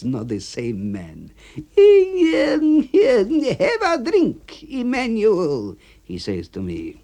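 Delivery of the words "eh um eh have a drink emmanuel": much higher, loud then slow coaxing; sudden friendly A B. Acted. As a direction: soften up and offer a drink.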